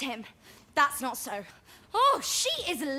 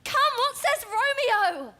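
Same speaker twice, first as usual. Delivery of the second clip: impatient; 7 semitones higher than usual. Acted. Juliet impatient for the news.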